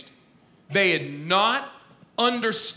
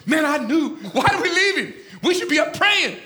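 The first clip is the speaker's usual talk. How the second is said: high, exasperated griping; comic mock complaint, high-pitched; Peter; 7 semitones higher than usual. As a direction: wail the regret, pitched up. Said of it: "Peter goes".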